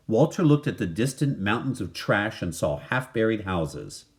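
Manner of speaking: plain reading voice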